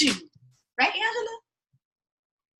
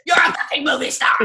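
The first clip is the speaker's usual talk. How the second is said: loud, near-tearful insistence; emotional shout; 2 semitones higher, 5 dB louder than usual. Retold or imitated. Insisting to Angela she's a star, a teary shout. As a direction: shout the truth at her with feeling.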